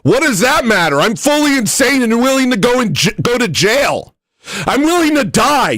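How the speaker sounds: high-pitched manic ranting; unhinged defiance. Pretend. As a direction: rant like someone with nothing to lose. Mock-insane defiant rant.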